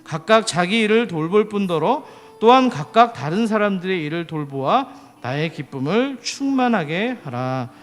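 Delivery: loud, measured recitation of a Bible verse; sustained, declaimed verse reading; scripture reading. Acted. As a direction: lead the congregation in reading aloud. Leads a scripture reading aloud.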